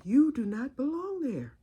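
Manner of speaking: high, measured, admonishing aunt; Aunt Ada. Retold or imitated. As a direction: imitate a proper aunt firmly correcting your path. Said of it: "That's just how she talks".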